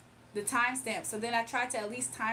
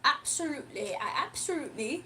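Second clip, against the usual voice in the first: light put-on British accent; 2 semitones higher than usual. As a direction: play a posh British host with a cup of tea. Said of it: "I've been talking like that, like, British a little bit".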